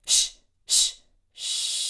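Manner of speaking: steady unvoiced hiss; consistent shh. Shown as a demonstration. Teacher models an even, consistent shh for singers. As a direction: keep the shh even and smooth. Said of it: "make a consistent sound with that shh".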